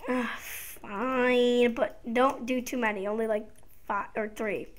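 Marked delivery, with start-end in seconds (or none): none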